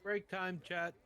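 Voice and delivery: loud high call to the chat; announcing break. Real, not acted. Announcing to stream.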